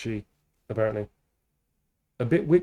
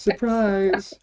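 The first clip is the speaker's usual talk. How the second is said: excited shout; 7 semitones higher, 3 dB louder than usual. Acted. Repeating the reveal.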